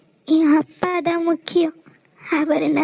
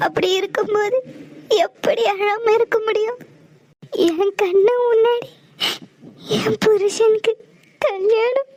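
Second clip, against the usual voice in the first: tearful, voice breaking, higher; crying while pleading; 4 semitones higher than usual. Acted. Wife weeping to Aadhi about his wedding, crying. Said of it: "அழாத".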